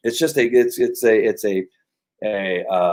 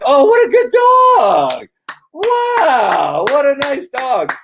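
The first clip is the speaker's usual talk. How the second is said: very high, slow, sing-song praise; shower the dog with delighted praise; gushing dog praise; 13 semitones higher, 5 dB louder, much slower than usual. Acted. Trainer praising his dog for looking away, high gushing praise voice.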